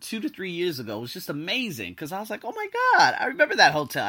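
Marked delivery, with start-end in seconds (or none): 2.4-4.1 high, excited exclamation easing down; excited recall. Retold or imitated. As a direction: blurt it out in mock amazement. Acted excited recall of hotel.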